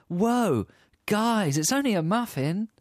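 high, placating plea; mock peacemaker. Friend defusing a couple's argument, placating. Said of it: "the 'whoa, man, guys' technique".

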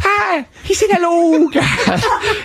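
high, laughing Irish sprite voice; lilting high leprechaun impression. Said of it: "You made him sound like a leprechaun".

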